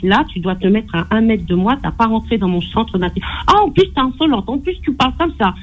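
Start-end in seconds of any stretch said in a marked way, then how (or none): 3.5-5.5 pitched-up mock of the brother's scolding. Caller imitating her brother scolding her, high mocking voice. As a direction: imitate him telling her off, voice pitched well up.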